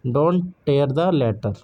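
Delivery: "tear" said like "tare", rhyming with "hair"; tear as in rip. Shown as a demonstration. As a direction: say "tear" to rhyme with "bear", not "beer". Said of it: "she shed a tear". ("tear" को "टेयर" बोलिए, "हेयर" की तरह)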